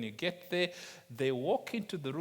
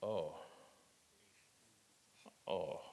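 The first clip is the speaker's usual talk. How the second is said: lower, softer, crestfallen oh; deflated oh; 10 semitones lower, 10 dB quieter than usual. Retold or imitated. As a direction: let the air out of it, disappointed. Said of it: "I'm like".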